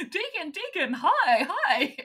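high, eager mock greeting; excited greeting. Jackie greeting Deacon at the masquerade, high and excited. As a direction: chirp his name like an overjoyed fan. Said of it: "she's like".

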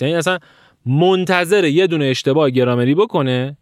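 ordinary delivery

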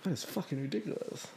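very soft muttered disbelief; inner reaction. Retold or imitated. Recalling paying the tax, muttered inner reaction. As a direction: say it under your breath. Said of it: "I was like".

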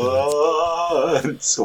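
drawn-out spooky moan; ghostly voice. Retold or imitated. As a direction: echo it like a haunting. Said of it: "said in a ghostly voice".

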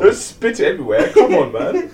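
giggling while scolding; laughing. Real, not acted. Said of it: "Ryan just spat his drink".

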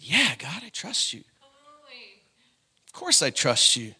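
low, soft reply in prayer; hushed retold reply; quiet assurance. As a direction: answer softly, almost privately. Retells own reply to God.

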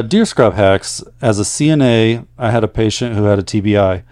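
letter-reading voice; reading a letter aloud. Acted. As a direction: read the listener's story out to the audience. Reads a listener's letter aloud.

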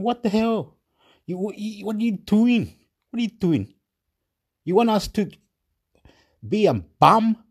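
high-pitched, exasperated outburst; indignant protest. Retold or imitated. People fed up with being left broke, indignant protest.

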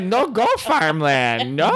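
high silly Gungan voice; Jar Jar voice. Pretend. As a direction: play it goofy like a clumsy alien. Jar Jar voice for Zsa Zsa.

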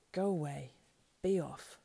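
loud, brusque order; angry dismissal. Acted. Sam driving the creature away, angry order. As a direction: send it off forcefully.

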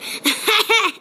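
short high-pitched laugh. Real, not acted. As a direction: burst into a quick laugh. Laughs after a deadpan remark.

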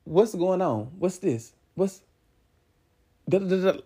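hushed, curious mumble behind the blinds; half-muttered, peeking through the blinds; a neighbour peeking out at a fight outside, mumbling to herself; nosy neighbour murmur. Retold or imitated. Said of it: "you peep out your blinds, like".

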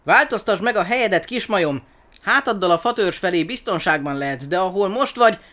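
high, loud, pressing plea; urgent warning. A narrator's reading. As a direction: urge them to move before it is too late. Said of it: "Twiga hangja sürgető lett".

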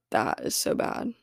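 normal talking voice

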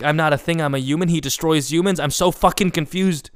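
exasperated confusion. Retold or imitated. Retold bewilderment at father's anger.

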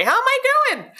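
very high, eager repeat of the question; excited. Retold or imitated. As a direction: ask again, bursting with anticipation. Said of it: "She was so excited".

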